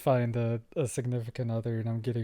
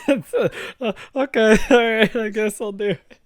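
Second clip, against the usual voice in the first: high, tearful giving in; mock crying; 9 semitones higher, 7 dB louder, slower than usual. Pressured into doing the voice, mock-tearful surrender. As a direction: whimper it loudly, hammed-up. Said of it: "You're, like, crying".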